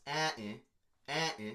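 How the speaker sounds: "eh, eh, eh, eh" tapped out evenly; vowel rhythm demo. Shown as a demonstration. Teacher beating out the shared stress. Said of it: "Same stress".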